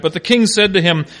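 normal talking voice

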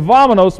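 vámonos said in Spanish, stress on first syllable, falling. Shown as a demonstration. Preacher teaching his church a Spanish word, clear and loud.

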